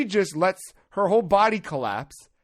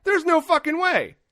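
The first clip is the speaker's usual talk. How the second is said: much higher, louder mock disbelief; incredulous inner voice; 9 semitones higher, 4 dB louder than usual. Comic reacting to the feeble lady helping, high incredulous. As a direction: squeal the disbelief at her asking. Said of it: "I'm like".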